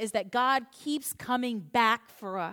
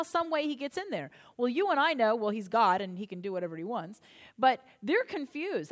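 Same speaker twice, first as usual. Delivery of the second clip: normal talking voice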